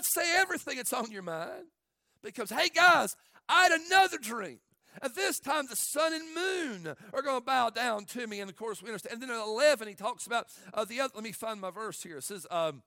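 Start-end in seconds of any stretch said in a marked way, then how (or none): 2.5-8.4 loud and high, bursting with news; eager Joseph. Acted. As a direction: blurt out the dream proudly. Joseph announcing second dream loudly.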